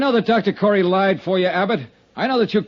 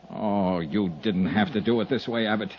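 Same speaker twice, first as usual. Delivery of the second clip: low, soft and grieving; sorrowful; 10 semitones lower, 7 dB quieter than usual. Acted. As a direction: speak gently to a dying man. Kneeling by the dying man.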